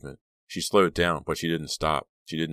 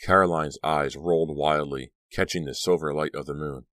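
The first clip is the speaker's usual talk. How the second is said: ordinary delivery, nothing marked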